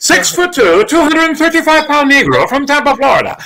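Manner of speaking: high announcer voice for the exact stats; high broadcaster call; Keith Jackson voice. Retold or imitated. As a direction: read the stats like a sportscaster. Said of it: "it had to be exact".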